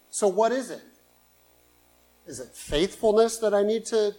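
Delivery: impassioned sermon pleading with the Lord; fervent preaching. Acted. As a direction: pour it out to God from the pulpit. Preaching at full force, prayer.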